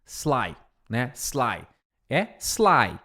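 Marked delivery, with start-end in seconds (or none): 0.0-0.6 "sly" starting straight on a hiss of S; no vowel before the S of "sly"; clean initial S. Shown as a demonstration. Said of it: "por exemplo". ("sly" sem I antes do S)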